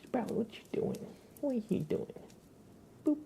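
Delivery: very high soft pet voice; talking to the pet. Real, not acted. Streamer asks her pet Sprout what it's doing, high pet coo.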